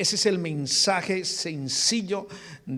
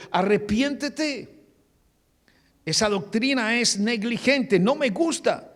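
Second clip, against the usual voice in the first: high, emphatic reprimand, first word drawn out; stern, forceful rebuke; 5 semitones higher, 4 dB louder than usual. Acted. The Lord rebuking the church of Pérgamo, forceful and stern. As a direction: lay down a blunt, raised-pitch rebuke. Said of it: "el Señor fue contundente con ella".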